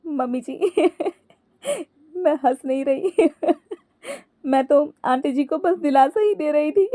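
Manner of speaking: giggling denial, high pitched; laughing. A narrator's reading. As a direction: protest through uncontrollable giggles. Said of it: "Ankita हंसते हुए ही बोली". (हंसते हुए सफ़ाई देना)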